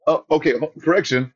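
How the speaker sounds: high, fumbling what-huh; mock confusion. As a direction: play a flustered announcer, pitched high. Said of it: "I'm not gonna stop and say".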